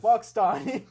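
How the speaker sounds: loud jeer. Real, not acted. Friends bashing a rival loudly.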